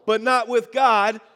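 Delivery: loud, slow, emphatic declaration; like you mean it. Shown as a demonstration. Pastor leads the congregation in a recitation, loud and emphatic. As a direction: proclaim every word with full conviction. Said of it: "We're gonna say it again".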